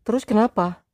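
high put-on wife voice, curt. A narrator's reading. Wife curtly questioning her husband, high voice. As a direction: snap the question out sharply as the wife. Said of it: "tanyanya ketus".

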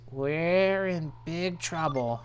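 drawn-out worry. Acted. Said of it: "And he goes".

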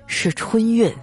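put-on fortune-teller's leisurely punchline; unhurried deadpan reveal. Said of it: "悠悠地跟我说". (悠悠地揭晓)

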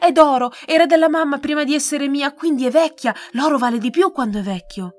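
put-on a girl desperately offering her necklace; urgent pleading girl. A narrator's reading. Girl bargains with soldier.

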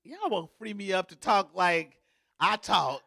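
ordinary delivery

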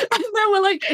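giggling through the words; laughing mid-sentence. Real, not acted. Laughing while telling meme.

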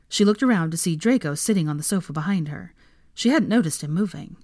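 plain reading voice

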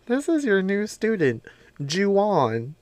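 high, mock principal voice announcing the new kid. Principal introducing the new kid to class, in a high lady voice.

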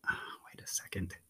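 suspicious hushed whisper; a conspiratorial aside. Acted. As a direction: lean in and whisper it like you just caught on. Realising the book tricks him.

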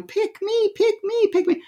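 put-on a kid begging to be chosen; eager soul. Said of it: "raising both arms going".

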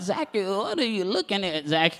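lightly hammed-up old wife; old woman. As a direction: play the puzzled elderly wife. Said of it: "'Cause she's old, remember, she's old".

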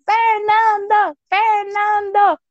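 high, drawn-out excited cheer; imitated child's screaming. Child running round during race, imitated excited screaming. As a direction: call the name high and slow, re-enacting childhood screams. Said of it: "me running around screaming".

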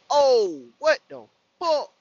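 loud, high outburst; big acted disbelief; retold shock. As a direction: blurt it out, stunned and loud. Shock at friend's retold question.

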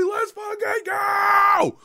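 high, loud, slow stretched shout; retold victory shout; hype shout. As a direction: scream it like a fan in the stands. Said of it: "I was like".

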